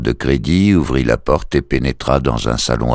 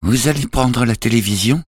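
high woman's voice, questioning; worried young mother; 9 semitones higher than usual. A narrator's reading. Mother asking the debt collector about her television, high-voiced question. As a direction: ask as the mother, pitched well up.

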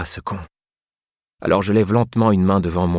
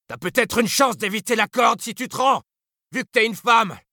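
much higher, commanding lawman voice. Acted. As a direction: call it out, firm and steady. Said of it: "lance le marshal".

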